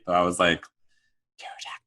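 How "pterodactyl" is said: barely audible, hushed; under the breath. Retold or imitated. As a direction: sneak the word out quietly. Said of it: "under my breath, todo calladito".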